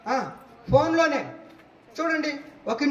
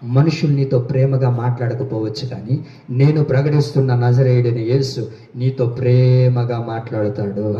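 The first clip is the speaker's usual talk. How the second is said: voice of Jesus; 5 dB louder than usual. Retold or imitated. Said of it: "అయితే యేసయ్య అంటారు".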